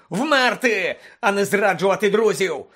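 loud, high, indignant bellow; raging outburst; furious roar. Acted. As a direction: roar the reproach at full force. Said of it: "заревів Блек".

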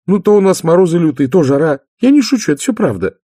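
high, loud, quick and insistent; youngest sister, impatient. Acted. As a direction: protest as the excitable little sister.

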